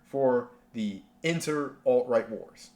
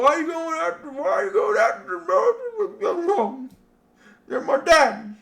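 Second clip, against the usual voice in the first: very high whiny mock voice; hammed-up squealing complaint; mocking whine; 5 dB louder than usual. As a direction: whine like a panicked fanboy. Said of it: "It's like, Oh, dude".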